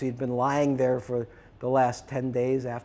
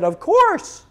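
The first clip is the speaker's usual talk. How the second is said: eager insistence; 10 dB louder than usual. Retold or imitated. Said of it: "He said".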